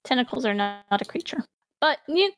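normal talking voice

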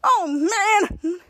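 drawn-out exclamation of mild dismay; mock dismay. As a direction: groan it like a thwarted character. Retold character exclaims in dismay.

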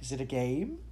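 lifted, hopeful query. A narrator's reading. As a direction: ask with a touch of hope. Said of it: "he asked hopefully".

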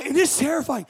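ordinary talk, nothing marked

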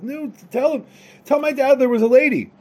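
high, loud, insistent appeal; urgent pleading. Acted. Son asking the drunk sailors to back him, pleading urgently. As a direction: push the voice up, demanding a witness.

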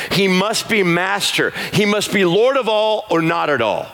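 high, driving preacher's call; fiery pulpit exhortation; full-force preaching. Acted. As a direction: challenge the room hard. Preaching climax, challenging the room.

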